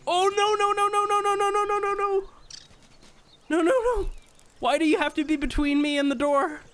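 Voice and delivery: high rapid-fire pleading, easing into whiny protest; panic, then complain at the thing trapping you; panicked. Real, not acted. Spider blocks way to the door.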